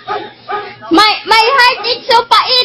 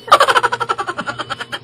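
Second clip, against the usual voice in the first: a real burst of laughter; bursting into laughter mid-skit; 2 semitones higher, 6 dB quieter than usual. Real, not acted. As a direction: laugh out at the silly act. Laughing at the fake quarrel.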